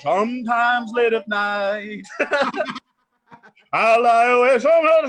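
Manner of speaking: loud, slow showman announcer voice; carnival announcer. Acted. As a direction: announce it like a fairground host. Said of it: "why do you sound like the carnival announcer".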